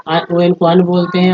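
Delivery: ordinary talk, nothing marked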